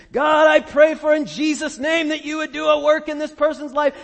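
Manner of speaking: loud, high intercessory cry; crying out in intercession. Retold or imitated. Preacher mimics his own intercession, a loud high cry. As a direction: cry out a fervent prayer for someone. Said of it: "crying out in intercession".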